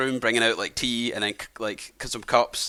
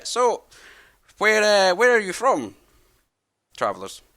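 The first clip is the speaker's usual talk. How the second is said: put-on higher, kindly old man voice; friendly old man; 10 semitones higher, 5 dB louder, slower than usual. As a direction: play a chatty old host welcoming travellers. Old man asks origin.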